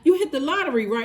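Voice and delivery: shrieked way up high in disbelief; clerk's shocked question. Retold or imitated. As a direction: play the stunned clerk, voice shooting up. Clerk reacts to lottery win.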